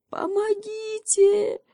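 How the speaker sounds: high, slow, stifled call from down in a pit; muffled cry for help. A narrator's reading. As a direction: cry out from the bottom of a hole, choked. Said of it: "придушенным голосом крикнул из ямы заяц". (придушенный крик из ямы)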